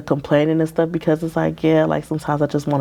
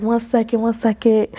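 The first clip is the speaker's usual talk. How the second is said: higher-pitched "one second", repeated; retold hold-on plea; 7 semitones higher than usual. Counselor keeping client on the phone, urgent. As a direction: stall someone about to hang up.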